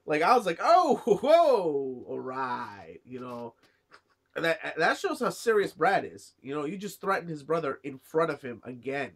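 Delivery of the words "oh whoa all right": an amazed, half-laughing "whoa" that trails off; stunned laughing whoa. Retold or imitated. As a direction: caught off guard, laughing in disbelief. Retelling own stunned reaction.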